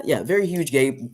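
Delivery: normal talking voice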